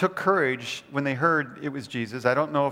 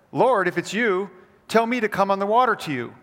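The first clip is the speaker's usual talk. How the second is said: louder and higher, urgently eager; eager bold request; 6 semitones higher, 5 dB louder than usual. Acted. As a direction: call out to Jesus with bold excitement. Peter's bold request on the lake.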